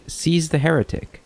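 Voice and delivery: drawn-out, half-hearted scream of accusation; mock scream. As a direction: imitate a zealot's cry at talking volume. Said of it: "then he starts screaming".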